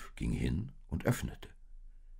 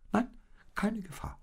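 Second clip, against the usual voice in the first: much higher, whispered agreement; little ones agreeing. Acted. The little ones whisper their agreement to the old sheep. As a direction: echo it in tiny hushed voices. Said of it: "stimmten die Kleinen zu".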